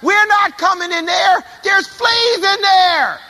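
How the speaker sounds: high, slow, shouted refusal; guards yelling back. Retold or imitated. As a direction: yell it back through the door like a scared guard. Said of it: "and they yelled back".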